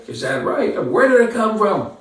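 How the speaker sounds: mock incredulous quote; incredulous mock question. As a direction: act out a skeptic's astonished question. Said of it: "Some say".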